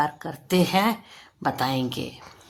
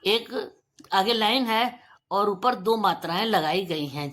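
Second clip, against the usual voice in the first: usual speaking voice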